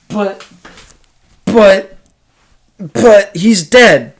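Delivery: hammed-up terrified Macbeth; mock horror. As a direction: stutter it out as if seeing a ghost. Macbeth sees the ghost.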